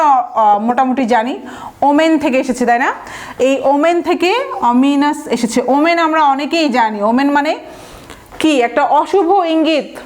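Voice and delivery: normal talking voice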